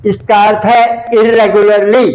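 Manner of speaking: normal talking voice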